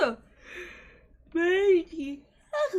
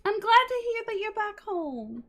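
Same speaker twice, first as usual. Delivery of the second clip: high, put-on little toy voice; cute toy voice welcoming someone back; plush toy voice; 4 dB louder than usual. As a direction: chirp it brightly as a tiny stuffed friend.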